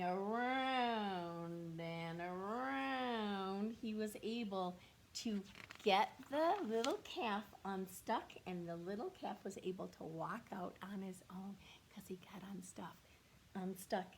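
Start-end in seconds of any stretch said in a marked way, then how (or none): 0.0-3.8 very slow, stretched around and around; drawn out. Shown as a demonstration. Teacher slowly traces Otis going around the pond, very slow. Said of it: "slow demonstration". 8.5-13.0 soft unvoiced whisper. Real, not acted. Teacher whispers the explanation to the class. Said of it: "teacher whispers".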